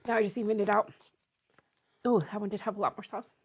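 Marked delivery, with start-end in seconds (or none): none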